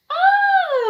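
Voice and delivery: high loud gasp of surprise; delighted gasp. Acted. Child gasping at the cats, loud high gasp.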